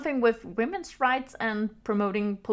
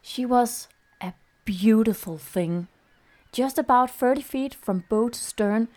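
story narration. A narrator's reading. Reads the story over music.

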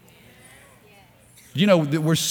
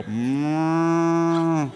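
imitated cow moo. Pastor mimics a cow for the church, lightly voiced moo. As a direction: hum a short cow moo. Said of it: "When you hear it go".

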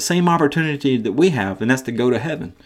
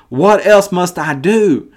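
higher, louder, drawn-out eager plea; excited question; 5 semitones higher, 5 dB louder, slower than usual. Retold or imitated. Young man asks Jesus what remains, excited. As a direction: stretch it slowly with raised, eager pitch. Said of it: "because he's all excited".

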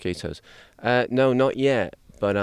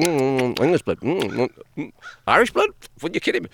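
high, savouring hums then a shocked squeal; mosquito wine taster; 5 semitones higher, 3 dB louder than usual. Pretend. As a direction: sniff the blood like a sommelier.

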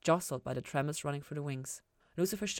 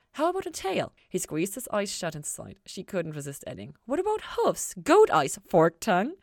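much higher and louder, gleeful needling; teasing; 4 semitones higher, 8 dB louder than usual. A narrator's reading. Ella teasing Lucifer about devil cliches, gleeful and teasing. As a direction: wind him up with each cliche.

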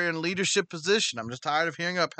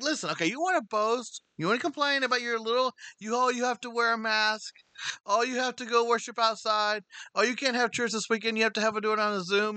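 high-pitched mock complaint; exasperated, raised-pitch mimicry of grumbling; mocking complainers; 6 semitones higher than usual. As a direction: scold them by parroting their petty grumbles. Paul scolding modern complainers, mock whining.

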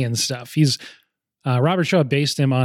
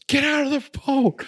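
much higher, sudden panicked cry; panicked mom; 13 semitones higher than usual. Retold or imitated. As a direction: shriek it like a mother who just saw the shark. Mom sees shark picture, panics.

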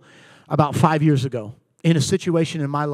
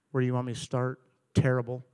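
low, soft, downcast grumble; negative person; 6 semitones lower, 11 dB quieter than usual. Acted. Negative person answering how they are, glum and low. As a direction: answer glumly, low and quiet. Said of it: "one negative person that's always negative".

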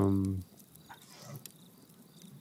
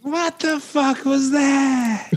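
shout; much slower than usual. Acted. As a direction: yell it out in shock. Mocking tiger pounce reaction.

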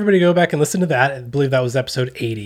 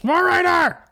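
mock squeal; slower than usual. Mocking the tourist line.

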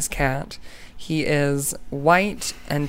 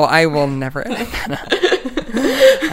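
laughing; 6 semitones higher, 5 dB louder, slower than usual. Real, not acted. Teasing after shout out.

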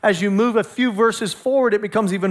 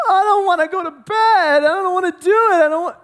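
high, loud mock tantrum wail; whining child; 11 semitones higher, 5 dB louder, faster than usual. Daughter refusing bedtime to dad, mock tantrum wail. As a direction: whine like a kid refusing bedtime.

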